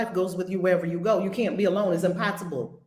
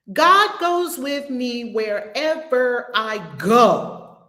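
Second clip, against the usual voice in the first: slow run that lands on a loud, drawn-out GO; stress on GO; 3 semitones higher, 4 dB louder, much slower than usual. Shown as a demonstration. Teacher finishing the emphasis drill, heavy stress on last word "go". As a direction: save the punch for the final "go". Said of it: "put a different emphasis on each word".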